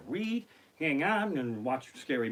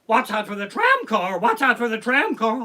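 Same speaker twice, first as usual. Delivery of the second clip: high, loud repeated warning call; nightmare flashback warning; 7 semitones higher, 9 dB louder than usual. Retold or imitated. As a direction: imitate the tram's alarm call, hammed up. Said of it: "I'm still having nightmare flashbacks".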